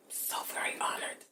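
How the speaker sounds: whispered, choked with feeling; hushed and moved; emotional whisper. Real, not acted. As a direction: breathe it out, moved. Emotional podcast introduction.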